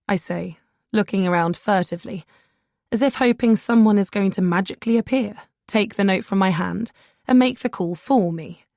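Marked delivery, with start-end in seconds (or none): none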